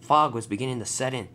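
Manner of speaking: plain reading voice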